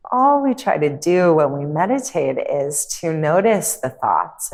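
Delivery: calm meditation guide, long pauses between phrases; guided meditation. Acted. Yoga teacher guiding listeners through a visualization, calm meditative delivery. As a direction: ease them into stillness, unhurried and measured.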